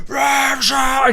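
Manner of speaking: high, loud yelled name; mock scream. Angry man yelling at his girlfriend, mock scream. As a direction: scream her name in a put-on rage. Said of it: "and you scream at her".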